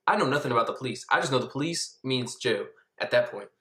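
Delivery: normal talking voice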